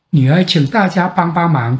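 ordinary delivery

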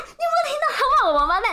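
fast, high, loud mock complaint; outraged appeal. Imitating the old man calling for a witness, fast shrieking indignation. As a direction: call someone to witness, wildly indignant.

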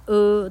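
呃 as a neutral "uh" vowel; 呃 pronounced like the a in "about"; unstressed schwa. Shown as a demonstration. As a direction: say 呃 as a held schwa sound. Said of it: "它在不重音的时候念呃".

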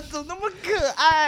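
high, delighted laugh into the question. Real, not acted. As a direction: break into giggles at how cute it is. Cracking up at the story.

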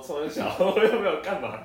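high, loud, indignant and laughing; playful mock outrage; mock indignant retort. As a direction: act out the defiant comeback. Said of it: "我就想说".

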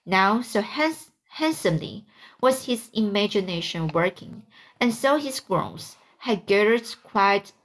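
usual speaking voice

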